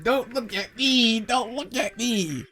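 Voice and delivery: high, slow, soft whine; mock guilty plea. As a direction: plead to be spared her sad stare. Retold guilty plea under her gaze.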